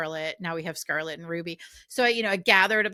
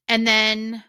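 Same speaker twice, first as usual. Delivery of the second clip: normal talking voice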